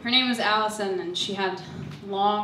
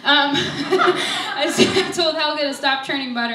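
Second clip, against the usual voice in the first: nervous laughter; 7 semitones higher, 8 dB louder than usual. Real, not acted. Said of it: "I'm so nervous".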